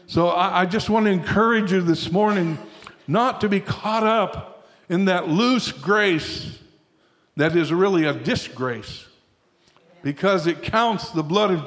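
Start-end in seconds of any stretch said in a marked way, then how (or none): none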